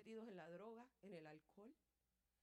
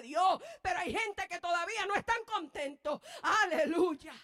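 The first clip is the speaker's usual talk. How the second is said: loud, rising preacher's cry; full-force sermon, building up; fervent preaching; 3 semitones higher, 16 dB louder than usual. Acted.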